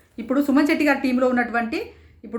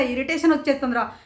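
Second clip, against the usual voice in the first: ordinary talk, nothing marked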